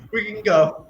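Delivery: high, giggly echo of the line; giggling through a mock retreat; laughing repeat. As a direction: say it while breaking into laughter.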